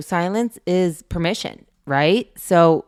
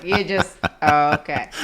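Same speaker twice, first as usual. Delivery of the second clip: laughing. Real, not acted. Amused reaction to son's question.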